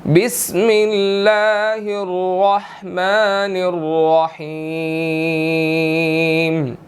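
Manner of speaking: slow, drawn-out chanted recitation; measured tilawat before the lesson; Quranic recitation. Acted. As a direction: recite it slowly, stretching each vowel.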